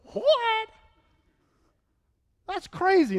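hammed-up gasp of disbelief; mock shock. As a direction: act floored by the news. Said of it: "you guys would be like".

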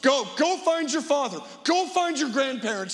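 put-on a panicked mother shouting at her children to run; mother screaming. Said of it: "she was screaming".

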